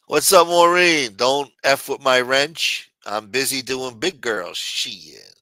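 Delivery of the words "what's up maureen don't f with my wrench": loud, slow greeting. Real, not acted. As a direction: call out the name loudly.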